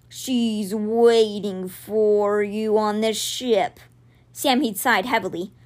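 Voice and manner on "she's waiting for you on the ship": very slow, drawn-out big-cat voice; giant cat. A narrator's reading. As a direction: stretch the words like a great calm beast. Giant cat's dialogue.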